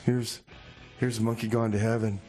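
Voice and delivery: usual speaking voice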